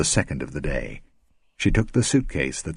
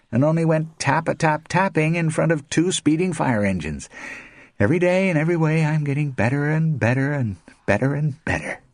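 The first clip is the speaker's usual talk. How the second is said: bitter mock cheer; 11 semitones higher than usual. A narrator's reading. Blind man's bitter mock cheer.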